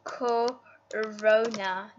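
drawn out slowly, sounding it out; slow careful spelling. Shown as a demonstration. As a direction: stretch each syllable of Corona slowly. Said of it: "how to spell Corona".

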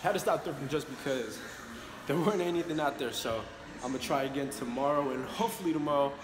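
distant, echoey room sound; recorded far off in a gym; echoing gym. Real, not acted. Filming in the gym.

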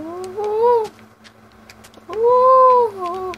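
high, loud startled ooh. Real, not acted. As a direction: let out a startled squeal. Startled in the game.

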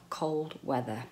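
normal talking voice

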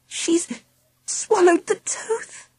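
high, soft and slow; faint horror. A narrator's reading. Charlie reporting the swallowed tooth, faint horror. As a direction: let it out weakly, in shock. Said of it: "I said faintly".

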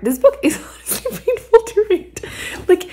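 laughing while talking. Real, not acted. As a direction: laugh through the words. Laughing at the painful book.

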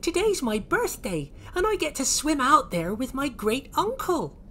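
very high, eager character voice; Oisin. Acted. Oisin tells strangers about his birthday, high and eager. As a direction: share birthday news in a high voice. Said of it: "said Oisin".

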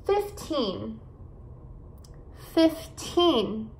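put-on FIFTEEN with a long TEEN; careful teacher's model of FIFTEEN, weight at the end; fif-TEEN, last syllable stressed in FIFTEEN; stress on final syllable. Shown as a demonstration. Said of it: "esses números com teen".

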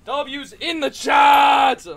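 high shout, last word stretched; hyped chat call. Real, not acted. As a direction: holler it slowly to rally the chat. Rallies stream chat.

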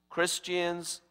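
ordinary talk, nothing marked

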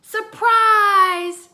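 loud, high, drawn-out party shout. A narrator's reading. Everyone jumps out at Muffy, shouting. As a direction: burst out shouting to startle the guest. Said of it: "shouted everyone".

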